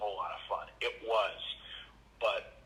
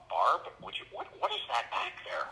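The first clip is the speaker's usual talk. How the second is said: shocked parent; 8 semitones higher than usual. Retold or imitated. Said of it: "I just picture some parent going".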